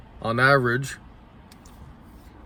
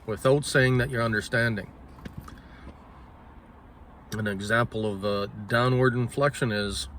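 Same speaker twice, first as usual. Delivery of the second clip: ordinary delivery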